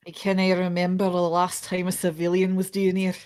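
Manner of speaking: put-on light Scottish accent for the governor. Governor greets the lawyers, lightly Scottish. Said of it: "I would love to do a Scottish accent".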